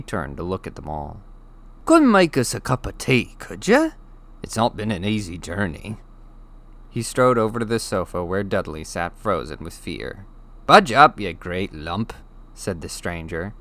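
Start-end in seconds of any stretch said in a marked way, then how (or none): 1.9-6.0 a big, good-natured stranger asking for tea; friendly giant. Acted. The giant asks the frightened family for tea, hearty. 10.7-12.1 a hearty shove of a command, voiced lightly; gruff giant. The giant tells Dudley to move over, gruff.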